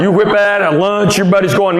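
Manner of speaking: ordinary talk, nothing marked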